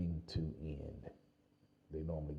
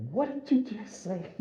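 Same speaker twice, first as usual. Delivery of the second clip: much higher, in disbelief; incredulous squeak; 5 dB louder than usual. Retold or imitated. Runner to Stuart, high and disbelieving. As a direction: squeak it out like you can't believe him.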